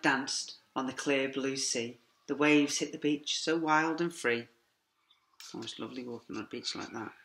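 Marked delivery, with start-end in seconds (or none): none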